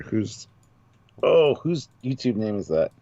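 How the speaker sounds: ordinary delivery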